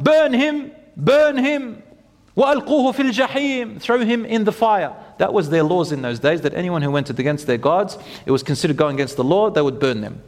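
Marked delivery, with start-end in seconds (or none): 0.0-4.9 high-pitched retold rallying cry; priest calling out. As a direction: imitate a priest urging the crowd to punish. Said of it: "One priest called out".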